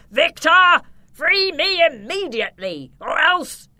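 high, loud enraged vampire yell; furious yell. Acted. The trapped Count threatens his nephew, furious high yell. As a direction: roar the threat from inside the cage. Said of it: "clenched his fists and yelled".

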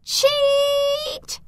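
very high, long-held loud yell; furious yell. A narrator's reading. Zelda yelling furiously after the rival far ahead. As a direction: screech the word after your rival. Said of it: "she yelled".

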